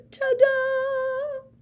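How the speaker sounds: bright game-show reveal; triumphant ta-da. Acted. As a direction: sing it out like a fanfare. Triumphant flourish after catchphrase.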